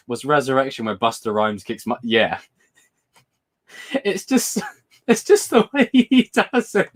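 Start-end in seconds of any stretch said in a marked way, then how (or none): 3.9-6.9 high giggling words; high squealing laugh over the words; laughing while talking. Real, not acted. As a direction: laugh through the sentence. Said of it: "I'm crying, guys".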